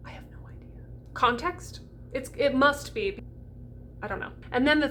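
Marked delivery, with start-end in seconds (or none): 0.1-0.8 whispered with a sigh; whispered aside. Real, not acted. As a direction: whisper it as a confession, sighing. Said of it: "unvoiced whisper".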